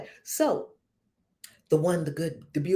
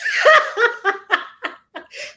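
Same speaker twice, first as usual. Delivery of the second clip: high laugh at herself; tickled self-mocking laugh; amused laugh; 6 dB louder than usual. Real, not acted. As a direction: laugh it off at your own expense.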